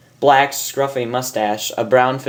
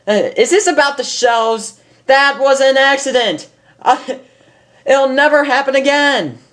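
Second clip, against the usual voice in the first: higher, louder, insistent pony; pleading Rainbow; 13 semitones higher, 4 dB louder than usual. Acted. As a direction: beg your way in.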